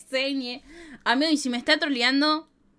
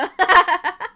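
bright, louder laugh; loud high laugh; 6 semitones higher, 11 dB louder than usual. Real, not acted. Laughing at the troll.

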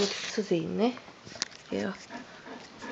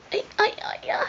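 soothing; 11 semitones higher than usual. Real, not acted. Soothing the pet.

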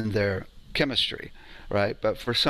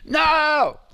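very high, loud "No!" yelled out; loud shouted no; 6 dB louder than usual. Retold or imitated. Depressed woman, shouting no on three. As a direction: belt out a huge "No!". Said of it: "a really loud no".

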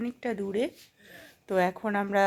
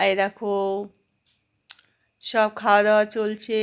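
normal talking voice

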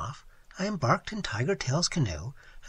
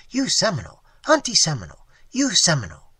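loud, emphatic bursts in broken English; imitated Seminole man; 3 semitones higher, 8 dB louder, faster than usual. Waitress imitates Tigertail claiming her as Seminole, emphatic. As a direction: insist forcefully in his voice. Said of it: "even imitating his voice and gestures".

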